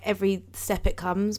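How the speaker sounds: usual speaking voice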